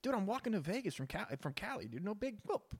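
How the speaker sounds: breezily lie about walking to Vegas; mock excuse. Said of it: "and be like".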